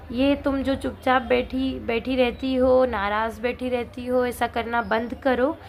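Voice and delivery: normal talking voice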